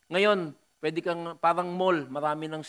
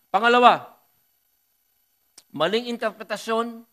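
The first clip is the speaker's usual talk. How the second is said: preacher loudly announcing his second point; loud emphatic point; 5 semitones higher, 7 dB louder than usual. Real, not acted. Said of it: "preacher stresses his second point".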